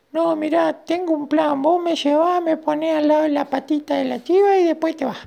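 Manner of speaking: very high, squeaky explaining; tiny ant voice. Acted. Ant explaining her plan to grandma, tiny squeaky voice. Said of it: "le dijo la hormiguita".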